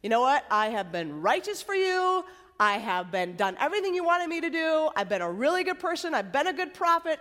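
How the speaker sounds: a prophet sulking and venting at God; hammed-up pouty protest; pouty complaint. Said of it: "he's still feeling a little bit pouty".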